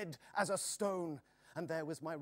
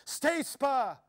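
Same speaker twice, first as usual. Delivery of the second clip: high, loud urgent shout; crying out; 8 semitones higher, 9 dB louder than usual. A narrator's reading. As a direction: call it out over the gallop. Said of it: "cried Joris".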